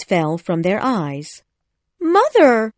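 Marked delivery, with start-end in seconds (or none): 2.0-2.7 put-on high, loud girl's call; Wendy crying out. A narrator's reading. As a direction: cry out for mother, high and loud. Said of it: "Wendy cried".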